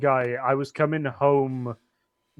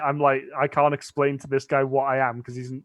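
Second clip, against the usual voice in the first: usual speaking voice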